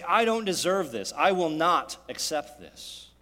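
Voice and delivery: loud, slow, defiant protest; indignant refusal. Retold or imitated. A resentful person turns on others, loud and defiant. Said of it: "and say".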